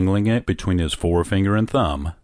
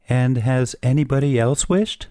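higher-pitched, lightly pressing question; persistent; 6 semitones higher than usual. A narrator's reading. Old lady presses the soldier about other wishers, persistent. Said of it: "persisted the old lady".

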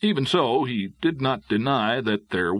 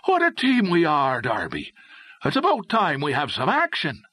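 high-pitched enthusiastic exclamation; exclaiming; 6 semitones higher than usual. A narrator's reading. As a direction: burst out, keen for action. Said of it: "Connor exclaimed".

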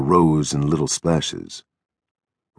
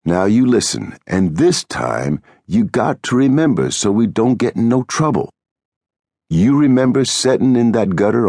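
firm, irritated instructing; exasperated George; 5 semitones higher than usual. A narrator's reading. George making Lennie listen and remember, exasperated.

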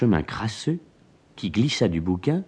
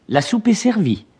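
much higher, light maid's voice; housekeeper announcing; 11 semitones higher than usual. A narrator's reading. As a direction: call out that dinner is served.

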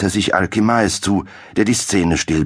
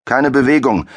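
high, loud command; barked order; 8 semitones higher, 4 dB louder than usual. A narrator's reading. Captain bursts in on the alchemist, shouting the order. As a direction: shout it at the intruded room. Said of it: "brüllte Hauptmann Krommel".

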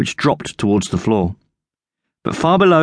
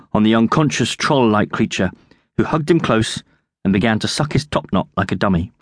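usual speaking voice

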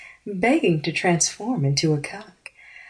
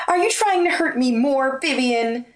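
higher, wounded voice of the blonde; higher, pained confrontation; hurt accusation; 5 semitones higher than usual. Acted. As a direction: accuse her through the hurt. Hurt ex-girlfriend confronts Vivian.

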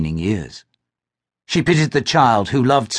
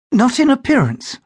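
put-on much higher, light young woman; Glynis's voice; 12 semitones higher than usual. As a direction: answer with a modest smile. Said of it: "she said".

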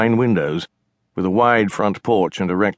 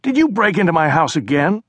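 higher, louder accusing question; exasperated sister; 9 semitones higher, 3 dB louder than usual. Acted. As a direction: demand it, fed up with him. China confronts her brother.

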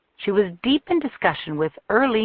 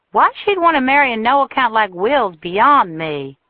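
louder, higher scoff; scoffing diner manager; 5 semitones higher, 6 dB louder than usual. A narrator's reading. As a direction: sneer at the match. Earlene scoffing.

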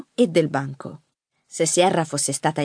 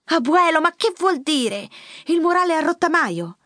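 high, loud incredulous question; baffled granddaughter; 11 semitones higher, 3 dB louder than usual. A narrator's reading. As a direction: question him in disbelief. (nipote incredula, voce acuta)

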